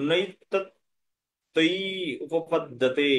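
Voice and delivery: slow syllable-by-syllable recitation; original pronunciation. Shown as a demonstration. Teacher drilling students on the verse, slow, segmented pronunciation. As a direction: build up নুই তৎ তুই উপপদ্যতে piece by piece. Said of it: "মূল উচ্চারণটা আপনারা".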